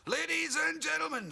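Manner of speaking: high, loud announcer build-up; mock stadium announcer. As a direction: introduce the star to the crowd. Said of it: "And he'd go".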